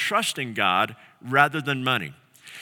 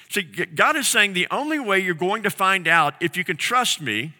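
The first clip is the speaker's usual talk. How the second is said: ordinary talk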